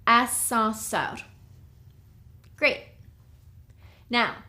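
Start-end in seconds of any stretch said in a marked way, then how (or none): 0.0-1.2 Ascenseur slowed as the model answer after a pause; Ascenseur, given slowly for learners to check; slow answer key Ascenseur. Shown as a demonstration. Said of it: "How would you say elevator in French?".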